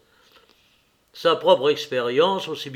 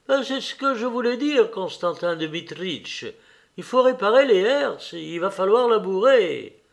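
higher-pitched steward voice; high-voiced estate steward; steward excusing himself; 5 semitones higher than usual. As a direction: play the steward making his excuses, pitched up. Voices the intendant in dialogue.

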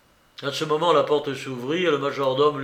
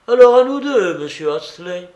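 loud, higher burst of defiance; defiant challenge; 5 semitones higher, 11 dB louder than usual. Retold or imitated. Narrator defying his rival, a loud burst. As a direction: throw down the gauntlet.

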